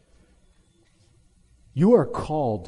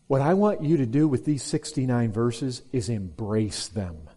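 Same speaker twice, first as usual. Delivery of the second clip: ordinary talk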